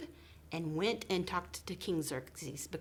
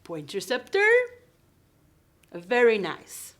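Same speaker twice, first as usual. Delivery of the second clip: put-on a louder, firmer cue to the kids; 4 semitones higher, 10 dB louder than usual. Shown as a demonstration. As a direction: boost the prompt so every child joins. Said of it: "did you hear me say king?".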